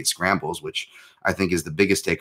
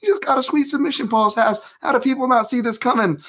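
much higher, excited shouting announcer impression; Rogan freaking out; 4 dB louder than usual. Fighter just won, commentator freaking out in a high excited shout. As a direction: hype it up like a fight commentator losing his mind. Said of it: "like, Rogan freaking out".

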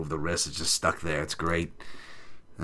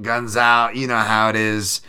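normal talking voice

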